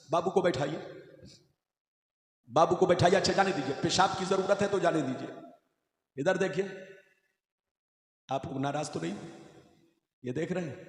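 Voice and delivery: normal talking voice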